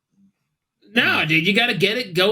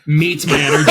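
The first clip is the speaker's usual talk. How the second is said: ordinary delivery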